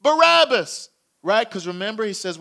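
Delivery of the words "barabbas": loud, high chanted name; raised-voice crowd demand, pitched high; crowd shouting. Acted. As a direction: call it out like a mob. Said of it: "you hear them shouting".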